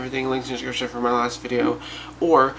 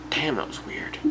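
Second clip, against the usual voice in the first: whispered; 7 dB quieter than usual. Retold or imitated. Waking startled, whispering to self.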